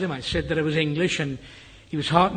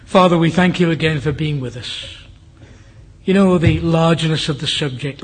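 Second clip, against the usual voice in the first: louder, fervent pulpit prayer; public prayer; 7 dB louder than usual. Acted. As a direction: lead the congregation in earnest prayer. Closing prayer to the congregation.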